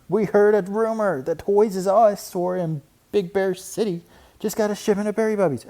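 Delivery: high, loud rush of news; excited news. A narrator's reading. Cubs blurting the toy rumor to Papa, high and excited.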